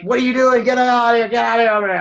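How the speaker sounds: re-enacted road-rage shouting, high and loud; crazed yelling. Man telling how he confronted a driver who cut him off, crazed yelling. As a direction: yell like you've lost it at another driver. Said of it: "a crazed look on my face".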